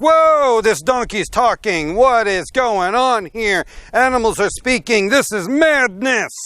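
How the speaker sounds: loud, high mock astonishment; hammed-up panic at a talking animal; freaked out. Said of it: "like, freaked out over it".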